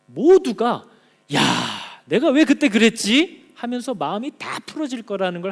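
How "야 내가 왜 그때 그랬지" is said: louder, higher, slow rueful cry; regretful exclaim. Acted. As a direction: exclaim it in sudden realisation. Believers later regretting, acted.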